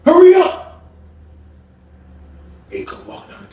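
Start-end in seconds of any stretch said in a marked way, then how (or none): 0.0-0.8 high, raised holler; imitated impatient yell; impatient calling. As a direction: hurry someone from afar. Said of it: "He's like".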